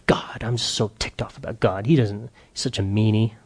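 whispered, pouting grumble; sulky whisper. Retold or imitated. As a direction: sulk it under your breath like a kid. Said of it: "David's like".

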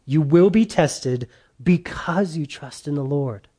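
usual speaking voice

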